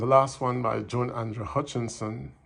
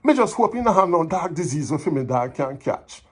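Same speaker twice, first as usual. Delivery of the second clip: higher, louder than chat, indignant complaint; dramatic poem recital; 6 semitones higher, 7 dB louder than usual. Acted. Neighbour complaining about the mangy mongrel dog, animated recital. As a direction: perform it as animated Jamaican Patois verse.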